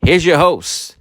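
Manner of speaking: loud, big show-intro announcer. Acted. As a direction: announce it like a TV promo.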